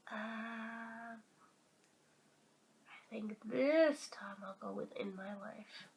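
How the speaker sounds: soft whispered pick. Real, not acted. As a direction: whisper your choice. Whispered choice.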